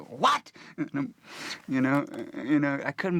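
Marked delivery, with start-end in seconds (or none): 0.1-0.5 high, loud disbelieving what; shocked what. Retold or imitated. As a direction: blurt it out in disbelief. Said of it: "I said".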